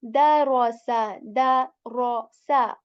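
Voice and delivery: "Da" thin and light, empty mouth, then "ro, sa". Shown as a demonstration. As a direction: keep "Da" light and hollow. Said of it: "like empty mouth sound".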